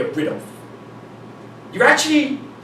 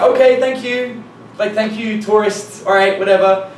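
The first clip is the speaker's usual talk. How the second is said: higher, louder mock-polite girl; dismissive brush-off; 4 semitones higher, 5 dB louder than usual. Retold girl brushing him off.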